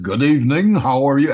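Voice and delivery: mock TV newsman greeting; news anchor voice. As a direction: announce it like the evening news. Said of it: "but I can talk like it".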